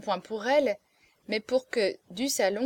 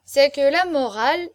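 loud, raised tipsy declaiming; drunken persistence; 4 semitones higher, 8 dB louder than usual. A narrator's reading. Drunk marquis argues his case to hecklers, dogged persistence. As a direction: start the argument with drunken insistence. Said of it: "l'imperturbable ténacité de l'ivresse".

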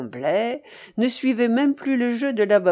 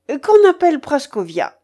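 loud, higher order; raised-voice mistress's command; 6 semitones higher, 8 dB louder than usual. A narrator's reading. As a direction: call out the order imperiously. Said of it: "commanda la babouchka à Marfa".

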